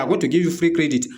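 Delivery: ordinary talk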